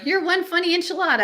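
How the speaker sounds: teasing. Acted. Teasing a friend.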